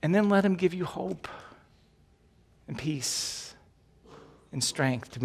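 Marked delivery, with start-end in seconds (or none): none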